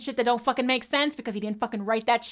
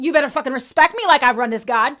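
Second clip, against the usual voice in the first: loud demand; 2 semitones higher, 9 dB louder, faster than usual. Retold or imitated. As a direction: bark it out like a self-righteous believer.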